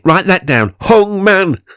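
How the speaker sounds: loud, high call across the room. Acted. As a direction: shout it to Janet down the hall. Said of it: "I called to Janet".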